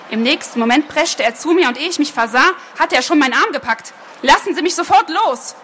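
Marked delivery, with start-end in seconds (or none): 4.2-5.6 higher, urgent but restrained yell; narrated cry. A narrator's reading. Girl to the man who grabbed her arm, contained scream. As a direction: demand release in a raised, high-pitched voice. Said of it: "schrie ich".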